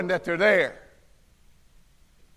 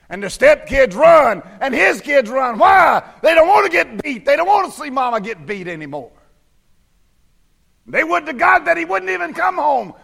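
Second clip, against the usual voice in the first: high, loud revival preaching; preaching at full force; 6 semitones higher, 5 dB louder than usual. Acted. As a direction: bring it up and hammer the congregation. Preaching peaks.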